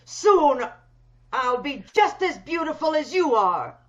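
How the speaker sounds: loud, gloating promise of beauty; loud gloating threat. Acted. Disfigured employer gloating loudly at her new victim. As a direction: gloat over her as you close in.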